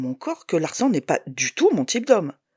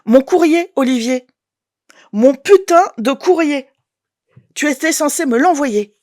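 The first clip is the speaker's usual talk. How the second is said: loud, higher, furious and slowed; angry outburst; 7 semitones higher, 9 dB louder than usual. Acted. Woman yelling at her ex about her mail, furious. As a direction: let the rage spill over.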